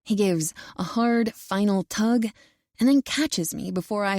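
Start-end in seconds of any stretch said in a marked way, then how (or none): none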